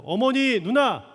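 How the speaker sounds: retold fearless declaration, preached out forcefully; bold proclamation. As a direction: declare it boldly, loud and high. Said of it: "이렇게 담대하게 선포했대요".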